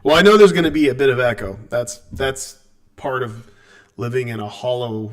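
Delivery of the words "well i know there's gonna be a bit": loud. Real, not acted. Jokingly blames himself, not mic.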